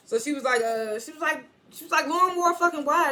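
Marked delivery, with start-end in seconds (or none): 2.1-3.1 high, loud retort; hammed-up fury, pitched way up; mock angry shout. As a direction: fire it back in her face. Effie snapping back.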